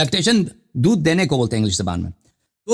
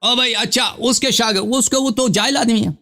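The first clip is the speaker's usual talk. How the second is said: higher, exasperated and scornful; heated argument; 6 semitones higher than usual. Real, not acted. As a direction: raise the pitch, fed up. Heated argument, calls him ignorant.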